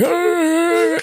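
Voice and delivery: high buzzing electro-larynx imitation; trach ring voice. Imitating the old guy at the ramp with a trach ring, high and buzzy. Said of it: "kinda spoke like he had a trach ring".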